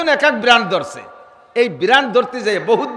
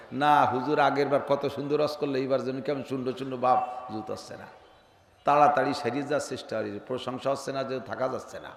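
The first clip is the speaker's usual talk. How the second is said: low, much softer voice for a grumbling listener; quiet mock complaint; 9 semitones lower, 12 dB quieter than usual. Listener grumbles to the preacher about a dull sermon, low and soft. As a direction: murmur it like a disappointed listener.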